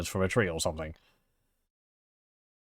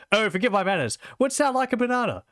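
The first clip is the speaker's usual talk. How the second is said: much higher, mock-gracious mad nobleman; hammed-up mad sultan voice, much higher; silly high sultan; 11 semitones higher than usual. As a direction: offer it like a batty host. Mad sultan offers a banana.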